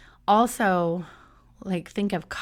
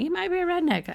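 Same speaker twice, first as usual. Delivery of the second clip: comic catchphrase punchline; 10 semitones higher than usual. Retold or imitated. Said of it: "when I was looking up all these".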